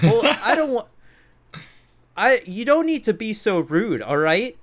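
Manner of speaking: high-pitched mock indignation; imitating offended customer. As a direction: raise the voice as a huffy, complaining customer. Voices offended customer confronting him.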